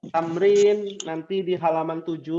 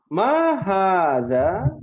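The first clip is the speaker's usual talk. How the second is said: slow drill question; 3 semitones higher, 4 dB louder, much slower than usual. Shown as a demonstration. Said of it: "teacher drilling class".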